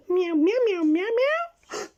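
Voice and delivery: high, loud string of meows, the last ones slow; chattering cat. Acted. Cat twin telling Lydia its idea, meowing. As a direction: meow out a whole plan.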